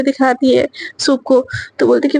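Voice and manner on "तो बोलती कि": usual speaking voice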